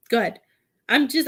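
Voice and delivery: ordinary delivery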